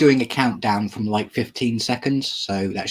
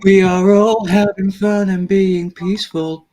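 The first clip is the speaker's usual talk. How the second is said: mock soothing; 7 semitones higher than usual. Mock calm reassurance.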